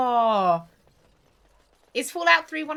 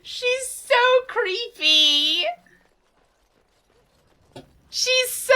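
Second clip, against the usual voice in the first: drawn-out; 14 semitones higher, 7 dB louder, much slower than usual. Real, not acted. Drawn out high creepy remark.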